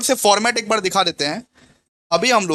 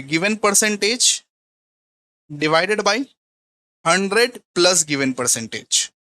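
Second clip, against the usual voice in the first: slow dictation; much slower than usual. Shown as a demonstration. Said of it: "तो आप क्या करोगे?".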